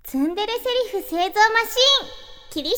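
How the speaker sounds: high, loud title announcement; title call. Acted. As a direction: announce the corner like a show title.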